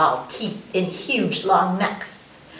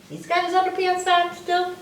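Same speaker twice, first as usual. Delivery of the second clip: ordinary talk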